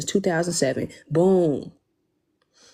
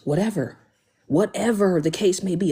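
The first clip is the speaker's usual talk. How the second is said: usual speaking voice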